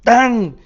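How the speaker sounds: high, loud imitated slap noise; loud slap sound. Retelling [name] slapping his arm, a loud imitated whack. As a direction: smack the sound out.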